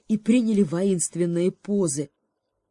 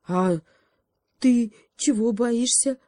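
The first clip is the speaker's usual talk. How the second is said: high, slow, pleading question; last hope; 6 semitones higher, 5 dB louder, slower than usual. Acted. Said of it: "с последней надеждой спросил заяц".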